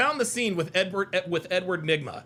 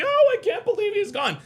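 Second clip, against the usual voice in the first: high-pitched mock wail of grief; Riddler's fake grief; 12 semitones higher, 3 dB louder than usual. Streamer acting out the Riddler's suicide-note act, fake grief. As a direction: wail it in hammed-up disbelief. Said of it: "He's like".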